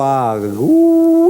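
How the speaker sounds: drawn-out storyteller's whoop; amazed whoop. Acted. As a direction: wind up into a big astonished "ooh". Amazed at ducks once more.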